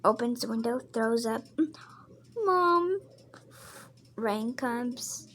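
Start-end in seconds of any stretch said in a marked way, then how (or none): 2.4-3.0 put-on child calling mom. Child character calls for mother.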